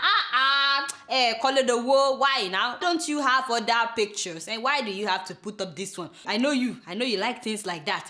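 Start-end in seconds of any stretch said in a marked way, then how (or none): none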